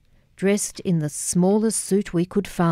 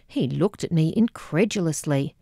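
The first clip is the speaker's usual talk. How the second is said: ordinary delivery, nothing marked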